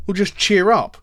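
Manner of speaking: put-on chirpy dismissal; glib advice-giver. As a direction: toss out useless cheer-up advice. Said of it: "and someone says".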